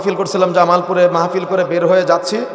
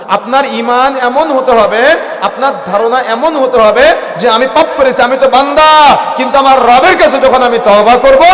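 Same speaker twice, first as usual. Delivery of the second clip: forceful preaching; 6 semitones higher, 8 dB louder than usual. Real, not acted. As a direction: stretch and push each phrase at the congregation.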